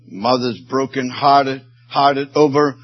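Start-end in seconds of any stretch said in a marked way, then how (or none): none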